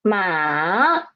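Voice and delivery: ma dipping low then rising; third tone ma. Shown as a demonstration. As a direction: dip ma low, then lift. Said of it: "Read after me, please".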